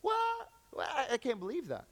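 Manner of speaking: high yelped double take; stunned disbelief. Acted. Seeing friends out on Instagram, shocked and hurt. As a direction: react like you've been betrayed. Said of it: "you're like".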